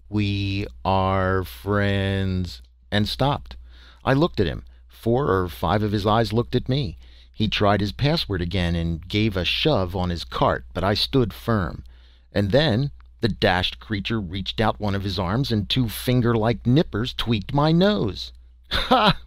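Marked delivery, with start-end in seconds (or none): 0.1-2.6 very slow, drawn-out alien drone; booming creature drone. A narrator's reading. Creature booming its password at the narrator. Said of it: "booming out his". 18.7-19.2 a sharp high burst of laughter; roaring laugh. A narrator's reading. Harrison roaring with laughter at Jarvis's story. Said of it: "roared Harrison".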